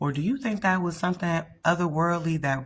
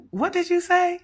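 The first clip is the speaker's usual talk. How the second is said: very high, incredulous retold question; nurse's startled reaction, acted out; 3 dB louder than usual. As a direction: ask it high, as if taken aback. Startled nurse questions the little boy.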